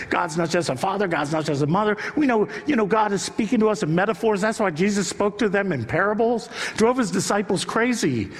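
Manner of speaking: ordinary talk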